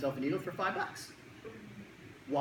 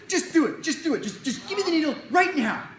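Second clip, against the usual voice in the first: put-on high, louder demanding kid; insistent kid; 10 semitones higher, 7 dB louder than usual. Kid marching up to the doctor, loud demand. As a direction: push it like a boy who wants it over.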